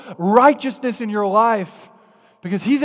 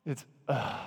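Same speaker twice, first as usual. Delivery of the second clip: a much softer under-the-breath groan; muttered ugh; 6 semitones lower, 13 dB quieter than usual. Shown as a demonstration. Showing how grumbling sounds, a soft muttered groan. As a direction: swallow the groan as if hiding it. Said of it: "It's just under your breath".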